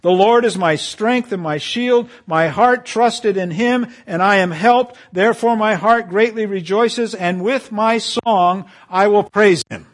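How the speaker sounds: high, loud rejoicing praise; exultant preaching. Acted. David praising the Lord before the church, a high, loud, rejoicing read. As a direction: ring it out in triumph.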